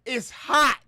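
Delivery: loud, high cry of it's hot; hammed-up heat complaint. Streamer joking to viewers about the heat, loud mock wail. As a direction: wail it like the heat is killing you.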